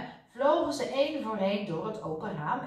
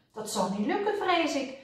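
ordinary delivery

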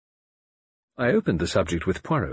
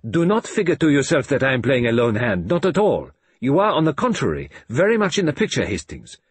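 put-on louder, insistent old detective; Poirot, earnest; 6 semitones higher, 5 dB louder than usual. A narrator's reading. Poirot insists Hastings is his eyes and ears, louder. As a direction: press the point with foreign care.

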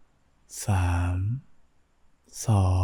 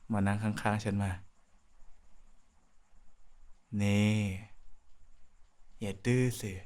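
soft coaxing; 10 dB quieter than usual. Acted. Coaxing partner to sit.

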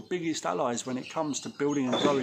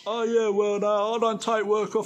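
mocking a prissy cleaner; 5 semitones higher, 5 dB louder than usual. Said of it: "don't try and be one of those".

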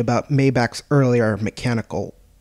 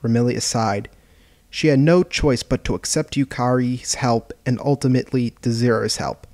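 ordinary delivery, nothing marked

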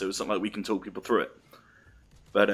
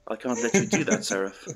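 lightly voiced computer impression; a hint of calm movie computer; Hal three thousand; 3 semitones higher than usual. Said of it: "like, Hal three thousand's voice".

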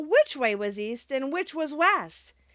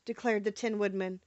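plain reading voice

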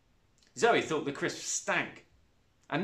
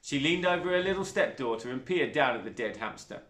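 plain reading voice

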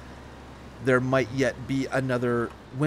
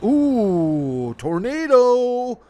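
high, slow, eerie ooh of a teasing dad; mock-ghostly warning, stretched out and loud; spooky dad teasing; 11 semitones higher, 9 dB louder, much slower than usual. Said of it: "he'd be like".

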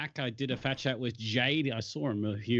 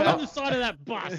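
pompous celebrity; 12 semitones higher than usual. Pretend. A friend mocking a celebrity's vanity, in a very high, laughing brag. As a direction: squeal it like a vain star pointing at his bus ad.

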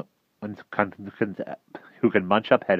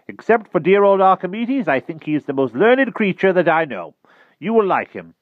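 higher, louder wizard voice; the old wizard, fond and proud; 12 semitones higher, 9 dB louder than usual. A narrator's reading. Merlin voiced in read story.

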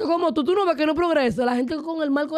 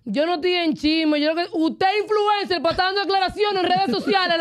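ordinary talk

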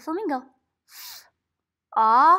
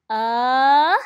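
rising uh sound; 11 dB louder than usual. Shown as a demonstration. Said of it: "my voice went up because we're going".

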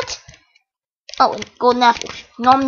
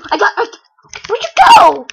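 ordinary talk, nothing marked

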